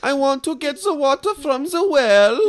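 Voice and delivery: very high, loud mock foreign accent; Jordan's bad accent. Host mocking guest's accent, loud high cartoon foreign voice. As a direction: belt out a silly singsong foreign accent. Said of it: "What the fuck is that accent?".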